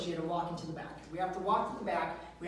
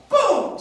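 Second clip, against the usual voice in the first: shouted boom effect; 12 semitones higher, 13 dB louder than usual. Retold or imitated. Sound effect of door falling.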